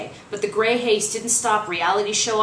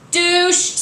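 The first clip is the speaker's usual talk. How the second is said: high, loud heckle; yelled; 11 semitones higher, 8 dB louder than usual. Acted. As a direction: shout it from the crowd. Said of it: "someone yelled".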